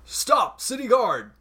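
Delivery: high, held-back yell without real volume; mock yell. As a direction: call out like a guard heard from behind. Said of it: "You hear behind you someone yell".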